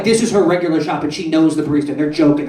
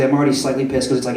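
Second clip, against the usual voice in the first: ordinary talk, nothing marked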